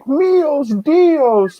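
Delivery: very high strangled cry; mock dying cry. Shot man holding his neck, high pained cry. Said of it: "but in Norwegian".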